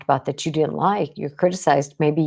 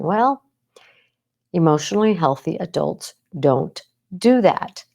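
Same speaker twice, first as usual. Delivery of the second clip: ordinary delivery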